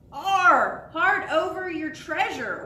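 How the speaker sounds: hammed-up pirate captain command; pirate captain order. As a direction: bark the order like a swaggering pirate. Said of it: "ordered the pirate captain".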